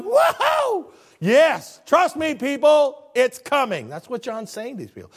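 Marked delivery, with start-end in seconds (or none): 0.0-3.9 put-on high, loud elderly apostle; a hint of a crippled old man crying out; ninety-six-year-old John, eager and loud; excited old John. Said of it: "he's just kinda like".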